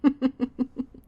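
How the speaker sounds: high, rippling chuckle; amused laugh. Acted. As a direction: laugh in a run of short bursts. Boss amused by employee's answer.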